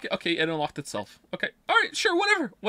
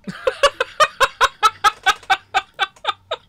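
high disbelieving laugh; 7 dB louder than usual. Real, not acted. Gamer laughing in disbelief at the ending, high helpless laugh.

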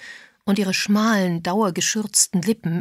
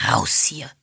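hissed. A narrator's reading. Mr Parr orders the visitors out, hissing. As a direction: hiss the order through your teeth. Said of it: "zischte Mister Parr".

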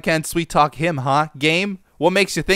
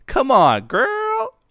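put-on high, sassy drawn-out girl; 7 semitones higher, 4 dB louder, much slower than usual.

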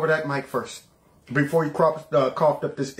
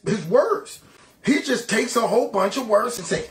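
normal talking voice

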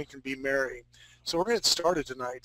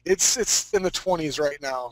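usual speaking voice

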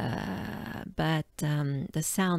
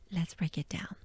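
normal talking voice

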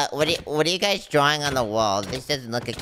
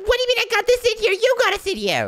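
indignant shout; 4 dB louder, faster than usual. Acted. Arguing with friend.

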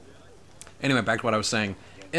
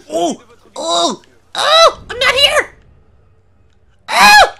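very high, loud squealed outburst; excited; 14 dB louder, slower than usual. Real, not acted. Streamer carried away by the game, excited high shrieking. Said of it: "I get a little excited".

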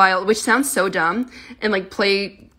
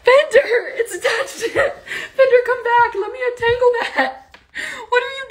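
high, laughing pet voice, much higher; 13 semitones higher than usual. Real, not acted. Owner laughing at her tangled kitten in high baby talk. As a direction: giggle and coo at the tangled kitten.

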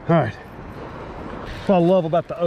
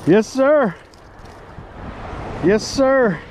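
triumphant yes sir; 5 semitones higher, much slower than usual. Real, not acted. Celebrating the catch.

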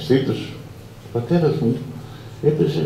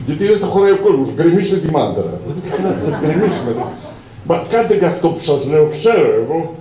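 bossy yelling; 7 semitones higher, 4 dB louder than usual. Retold or imitated. Boss ordering wall torn down.

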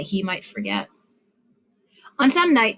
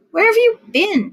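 put-on high, louder little-boy question; small child voice; 12 semitones higher, 6 dB louder than usual. Little brother greets him in a high, eager child voice. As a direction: pitch way up like an eager four-year-old. Four-year-old brother asks.

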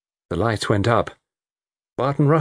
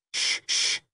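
soft unvoiced shush; hushing; 7 dB quieter than usual. Diners hushing the nervous women, whispered shush. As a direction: hush the table without a voice.

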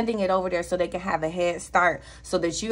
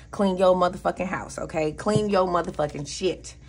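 normal talking voice

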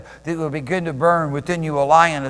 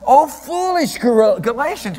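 slow, higher scolding apostle; lightly voiced rebuking Paul; 6 semitones higher, 3 dB louder than usual. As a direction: chide the church slowly and grandly. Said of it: "He says to the church".

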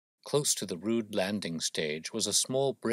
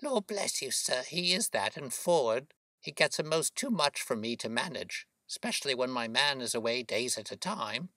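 high-pitched working woman's voice; chatty boatman's wife; 5 semitones higher than usual. Acted. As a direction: chat proudly as a high-voiced mother. Voices Mrs Smith, the boy's mother.

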